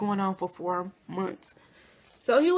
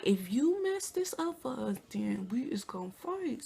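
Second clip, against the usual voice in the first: slow, soft warning that drops to a whisper; threatening hiss; 8 dB quieter than usual. Retold or imitated. Warning her cousin in the VIP, slow threat into a whisper. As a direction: threaten her under your breath. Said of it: "looking at her like".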